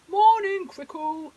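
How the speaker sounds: loud hearty hippo greeting; shout. Acted. Henry the hippo shouting hello to Crickle. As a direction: call out big and booming across the river. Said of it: "shouted Henry".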